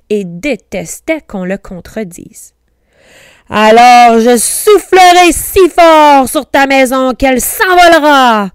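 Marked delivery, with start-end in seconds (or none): 3.5-8.5 loud, high wolf roar, rising to the end; furious storybook howl; enraged howl. A narrator's reading. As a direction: bellow the threat like an enraged wolf. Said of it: "hurla le loup enragé".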